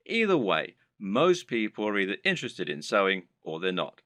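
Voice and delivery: ordinary talk, nothing marked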